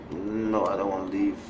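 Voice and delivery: ordinary talk, nothing marked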